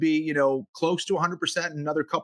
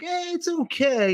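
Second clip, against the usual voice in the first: 1 meh shrug; 12 semitones higher, slower than usual. Retold or imitated. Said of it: "There's no one who's like".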